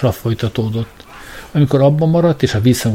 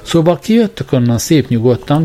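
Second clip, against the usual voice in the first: higher, louder cop's call from a distance; cop shouting; 6 semitones higher, 4 dB louder than usual. A narrator's reading. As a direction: bark the offer out across the distance. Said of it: "kiáltott az egyik zsaru".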